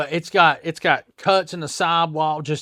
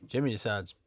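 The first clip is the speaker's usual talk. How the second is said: usual speaking voice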